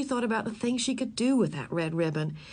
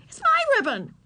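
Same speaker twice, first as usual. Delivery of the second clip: much higher, louder claim with MY hit; little girl insisting, much higher and louder; furious girl; 5 dB louder than usual. Acted. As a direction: stamp down on my. Girl quarrels with boy.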